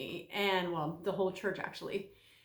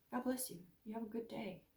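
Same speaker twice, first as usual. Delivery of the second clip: kind farewell; 11 dB quieter than usual. Retold or imitated.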